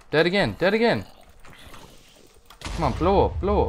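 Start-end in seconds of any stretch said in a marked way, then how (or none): 0.1-1.0 exasperated cry. Real, not acted. Repeated exasperated.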